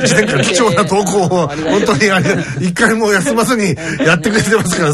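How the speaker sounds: high-pitched talk through laughter; real laughter bubbling through the words; laughing. Real, not acted. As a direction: keep talking while cracking up. Laughing while thanking the listener.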